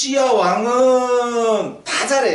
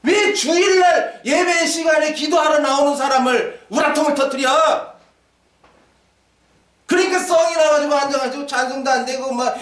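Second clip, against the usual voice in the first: preaching rebuke; 6 semitones higher than usual. Acted. Pastor scolding angry wives.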